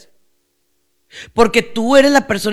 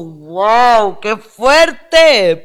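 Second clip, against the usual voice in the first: drawn-out "wow", then louder emphasis; amazed reaction; 4 dB louder, much slower than usual. Retold or imitated. Preacher reacting to the story's lesson, amazed. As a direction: marvel emphatically at a hard truth.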